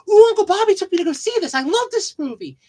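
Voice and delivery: put-on high, eager child's gush; excited kid voice. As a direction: squeal it like a thrilled kid. Excited kid asking for movie.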